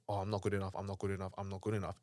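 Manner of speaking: soft, repetitive self-defeating mutter; self-doubting mantra. Acted. Speaker voicing someone stuck in failure, softly self-doubting. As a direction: say it small, as defeated inner talk.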